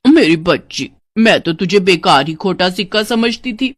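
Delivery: slow, drawn-out fawning with sly chuckles; gushing stepmother. Acted. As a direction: fawn over the stepdaughter, stretching key words. (चापलूस सौतेली माँ)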